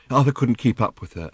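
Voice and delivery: ordinary delivery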